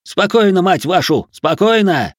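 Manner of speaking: loud, high shouts for order; roared command. A narrator's reading. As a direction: shout down a panicked crowd. Commander quelling a panicked crowd.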